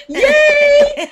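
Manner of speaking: high, loud, drawn-out yay; excited cheer. Acted. Blog readers delighted she doesn't know everything either, excited cheer. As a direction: cheer it out in mock celebration.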